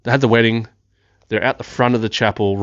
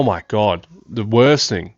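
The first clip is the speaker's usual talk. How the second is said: ordinary delivery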